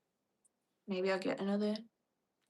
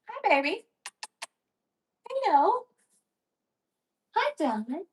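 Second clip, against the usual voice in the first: cooing pet greeting; 12 semitones higher, 6 dB louder than usual. Real, not acted. Greeting her bird in baby voice.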